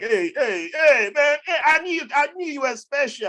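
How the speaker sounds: high, excited flattery; mock gushing relatives. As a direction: gush like a suddenly friendly relative. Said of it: "Everybody like".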